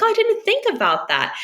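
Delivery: high, surprised exclamation; surprised client. Retold or imitated. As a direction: gasp it out like a delighted client. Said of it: "They're like".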